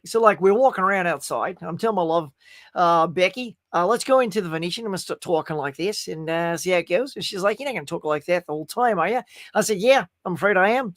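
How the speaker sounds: put-on Australian accent. Shown as a demonstration. Performer gives viewers a taste of his practised Australian accent. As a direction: retell it in a chatty Australian accent. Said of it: "I went full Australian".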